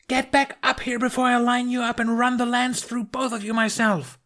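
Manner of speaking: high, furious command; stern order. Acted. Astrid ordering the twins out of the arena, threatening. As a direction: threaten them into obeying. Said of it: "Astrid ordered".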